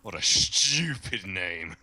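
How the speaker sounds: lightly voiced sneering character, slow and drawn out; slow, mildly put-on impression voice. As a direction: stretch the insults out slowly. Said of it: "makes quite a good impression".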